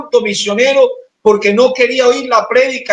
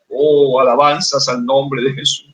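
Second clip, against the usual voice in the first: normal talking voice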